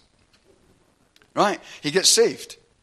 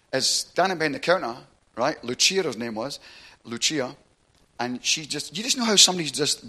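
usual speaking voice

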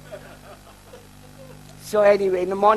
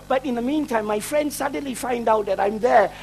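ordinary talk, nothing marked

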